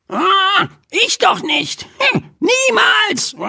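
very high, fast, chirpy bird voice; squealing magpie. A narrator's reading. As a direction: squeal with glee like a tiny magpie. Magpie Pinky answers Mortimer.

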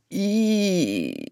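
long, high squeal of disgust; mock-disgusted exclamation; disgusted "eew". A narrator's reading. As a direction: draw out a grossed-out cry. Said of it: "rief sie".

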